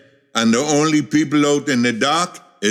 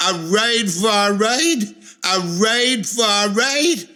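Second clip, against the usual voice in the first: put-on high, shrill-pitched indignant girl shouting; a scandalized young woman crying out to the whole square; outraged girl bawling; 8 semitones higher than usual. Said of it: "bawled out for everybody to hear".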